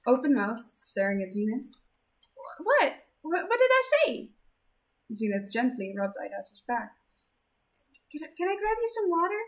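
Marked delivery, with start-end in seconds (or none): 2.4-4.3 much higher, startled stammer; alarmed and confused; flustered. Acted. Flustered Zenith. 8.1-9.4 higher, quick anxious offer; caring fret; worried girl voice. Acted.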